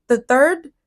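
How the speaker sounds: ordinary delivery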